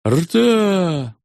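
high clipped shout; shouted command. A narrator's reading. Company commanders shouting to the ranks, a high shout. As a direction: snap it out to the company. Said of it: "крикнули тридцать шесть ротных командиров".

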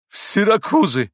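loud, high exclamation; astonished high-pitched outburst; bewildered cry. A narrator's reading. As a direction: cry out, not understanding a thing. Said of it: "вскричал штабс-капитан".

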